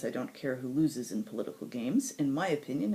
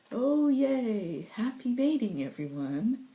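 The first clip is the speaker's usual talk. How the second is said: mock cheer; 9 semitones higher, 4 dB louder, slower than usual. Sarcastic cheer about fated mating.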